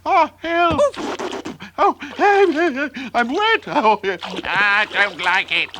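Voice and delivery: high, loud squealing protest; panicked kitten. A narrator's reading. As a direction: flail and yelp, soaked and upset. Kitten flailing in the water.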